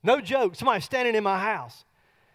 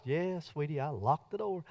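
sleepy mumble; 7 semitones lower, 12 dB quieter than usual. Retold or imitated. Sleepy reply to wife at bedtime.

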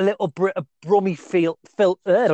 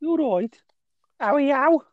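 high mock Brummie greeting; exaggerated Brummie caricature; Brummie accent; 7 semitones higher than usual. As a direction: put on a squeaky Birmingham accent.

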